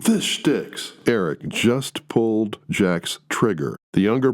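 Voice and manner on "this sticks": mocking. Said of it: "Eric mocks him".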